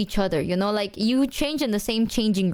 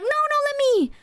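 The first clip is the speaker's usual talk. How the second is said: insistent friend; 3 dB louder, faster than usual. Retold or imitated. Friends fighting to buy drinks.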